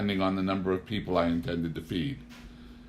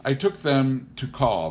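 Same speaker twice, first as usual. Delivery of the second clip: plain reading voice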